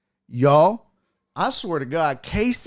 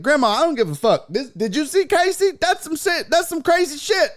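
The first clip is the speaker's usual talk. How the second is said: stunned excitement; 11 semitones higher than usual. Retold or imitated. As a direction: blurt it out, too amazed to care. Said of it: "I was like".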